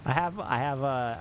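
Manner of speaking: normal talking voice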